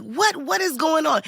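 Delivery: high-pitched imitation of a baffled director; exasperated director. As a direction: demand answers as the director. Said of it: "the director was like".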